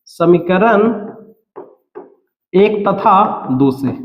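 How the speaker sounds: measured delivery with a long pause; slow dictation. Real, not acted. Teacher writing equations one and two, slow dictation. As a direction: dictate slowly as you write. Dictating the line being written.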